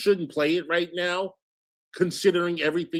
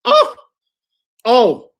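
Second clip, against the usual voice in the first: indignant; 9 semitones higher, 12 dB louder than usual. Real, not acted. Indignant outburst at accusation.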